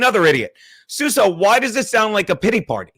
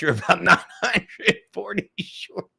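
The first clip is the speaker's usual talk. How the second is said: laughing; 4 dB quieter than usual. Real, not acted. Laughing reading chat.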